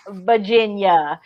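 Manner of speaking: "Virginia" as a slow "Vah-jin-yuh", Virginia drawl; Virginia accent. Shown as a demonstration. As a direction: draw out "Virginia" in a Virginia accent, dropping the r.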